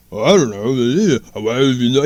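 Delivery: low, slow mumbling Stallone drawl; mumbly Stallone. Retold or imitated. Mocking Stallone's take on the movie in a low mumble. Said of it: "mumbly Joe number two".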